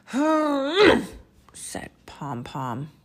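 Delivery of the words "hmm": loud, high cartoon grumpy "hmph"; hammed-up huff of annoyance; grumpy harrumph. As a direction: harrumph like a cross little panda. Grumpy panda harrumphs in story.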